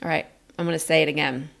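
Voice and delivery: ordinary talk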